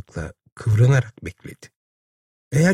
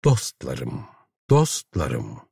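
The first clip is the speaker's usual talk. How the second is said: lower, softer, gently reproachful; a soothing voice from the machine, softly chiding; 9 semitones lower, 4 dB quieter, much slower than usual. A narrator's reading. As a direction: plead tenderly with the rioters. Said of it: "öyle yumuşak bir serzeniş tonuyla".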